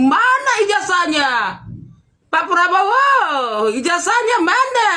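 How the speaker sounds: high, sneering mock of the man's demand, drawn out on Pak Prabowo; mocking imitation. Vlogger mocking the jailed man demanding the diploma, high mock voice. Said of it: "Kok teriak-teriak kamu".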